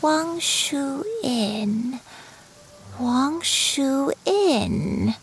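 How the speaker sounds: very slow, drawn-out words; slow, wondering old granny; musing recollection. Acted. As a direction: savour the name as memory returns. Granny recalling a familiar place.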